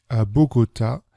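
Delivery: usual speaking voice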